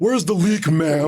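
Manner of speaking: mock cartoon voice. Quoting cartoon line in voice.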